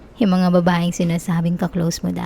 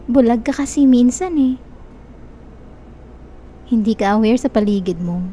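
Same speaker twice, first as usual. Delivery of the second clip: ordinary talk, nothing marked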